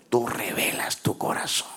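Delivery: whispered prayer words. Acted. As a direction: breathe it as a prayer.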